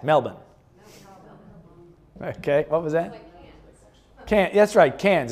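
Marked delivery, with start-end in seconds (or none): 0.0-0.4 quick, casual MEL-bn for Melbourne; Melbourne in one quick go, as locals say it; said fast. Shown as a demonstration. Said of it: "And you say it fast". 5.0-5.3 Cairns said as "cans"; Australian pronunciation. Shown as a demonstration. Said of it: "It's not Cairns".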